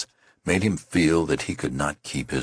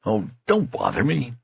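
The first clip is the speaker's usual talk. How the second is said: exasperated; 9 semitones higher than usual. Acted. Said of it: "cry of exasperation".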